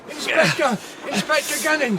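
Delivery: man calling for the inspector in the dark. Acted. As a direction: call for the inspector from afar.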